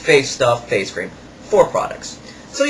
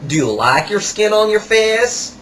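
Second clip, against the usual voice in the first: higher, slow put-on salesman voice; imitating the salesman; 7 semitones higher than usual. Kiosk salesman rubbing salt on a customer's hands, imitated higher and slower. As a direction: ham up the pushy kiosk seller. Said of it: "This is where he goes next".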